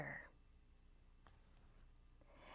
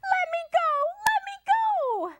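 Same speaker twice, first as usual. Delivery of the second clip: very high, loud squealing plea; piglet squeal; 6 dB louder, much faster than usual. Acted. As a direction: squeal to get free. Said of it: "The piglet kicked and squealed".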